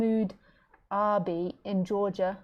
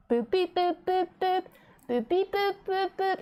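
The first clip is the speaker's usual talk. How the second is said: high beep-boop chirps; bird beeping; 10 semitones higher than usual. Retold or imitated. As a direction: mimic the bird's beeping, high up. Imitating the bird's sounds.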